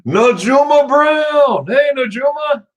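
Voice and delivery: high, slow shouted greeting; calling out. Real, not acted. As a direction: sing out the name like a cheer. Calling out a viewer's name.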